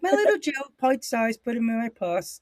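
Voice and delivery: put-on high playful voice of a British girl; lightly voiced British girl, sing-song and high; mock British girl. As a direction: coo it like a doting British girlfriend. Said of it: "cute little British accent".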